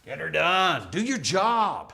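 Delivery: slow, stern coach command; lightly voiced gruff coach; coach order. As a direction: bark it like a football coach. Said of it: "Bill Belichick that said that".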